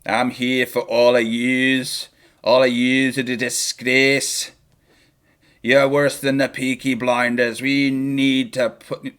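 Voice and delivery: slow, weighty Irish speech; drawn-out mock Irish accent. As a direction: deliver a menacing speech in put-on Irish. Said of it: "That's my Irish".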